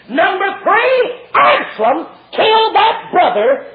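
high, drawn-out preacher's call; stretched, forceful announcement; shouted count. Real, not acted. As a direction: holler the point slowly. Shouted count of David's punishments.

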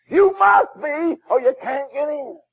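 usual speaking voice

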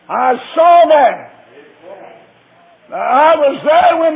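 loud, high, slow testifying; fervent pulpit witness; preaching at full force. Acted. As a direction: hammer each witness claim home. Testimony climax, preaching hard.

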